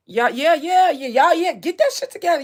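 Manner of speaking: loud, excited yeah yeah; loud hype. Real, not acted.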